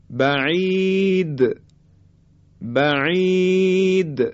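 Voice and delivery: "بعید" high and drawn out, د bounced at the end; qalqalah on د. Shown as a demonstration. Teacher models the letter dal in "بعید", bouncing qalqalah. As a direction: chant a long ī in "بعید", then release the د. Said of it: "حرف دال".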